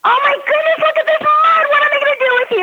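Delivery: put-on high, loud exasperated scold at the child; harsh scolding. Shown as a demonstration. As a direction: shriek it like a fed-up mom. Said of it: "If you had said".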